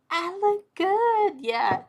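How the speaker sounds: high, loud and slow, showing off in jest; a playful brag, stretched out; mock boastful. Mock boasting about her looks.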